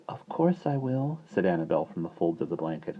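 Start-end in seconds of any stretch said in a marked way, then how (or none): 0.1-1.2 gentle promise. Acted. Baby promising slowly.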